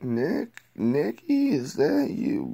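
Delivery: hammed-up old flame voice; mock woman calling. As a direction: call out to him sweetly. Old girlfriend voiced calling him.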